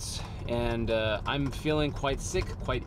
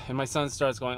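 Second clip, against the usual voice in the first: usual speaking voice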